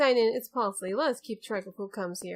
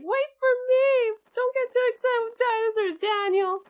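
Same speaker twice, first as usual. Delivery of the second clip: much higher and louder, calling after him; excited friend; 12 semitones higher, 4 dB louder than usual. Retold or imitated. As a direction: squeal after your friend who ran ahead. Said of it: "And then P C is like".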